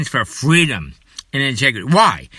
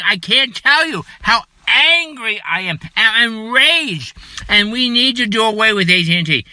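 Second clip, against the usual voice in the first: normal talking voice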